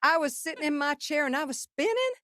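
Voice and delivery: high, breathless gushing [name] impression, well above own voice; imitating [name]. Telling how [name] raved about the songs, high and gushing. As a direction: gush like a thrilled Southern star. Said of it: "And she's like".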